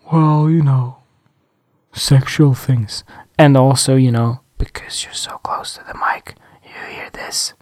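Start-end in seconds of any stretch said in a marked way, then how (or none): 0.1-3.0 slow put-on sultry purr; mock sultry voice. Shown as a demonstration. Parodying phone-sex podcasters, hammed-up sultry. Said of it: "whisper in your ear". 4.0-7.5 breathy close-mic whisper; whisper demo. Shown as a demonstration. Showing what he won't do, whispered. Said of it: "just whisper".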